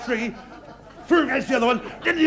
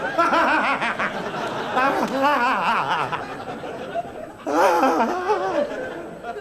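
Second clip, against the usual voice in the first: long, high hyena cackle; hyena's laugh; 5 semitones higher than usual. Acted. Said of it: "laughing hyena".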